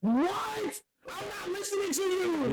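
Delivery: high-pitched, disbelieving rejection; incredulous. Acted. Host refusing to hear a bad anime take, incredulous.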